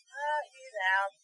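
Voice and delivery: ordinary talk, nothing marked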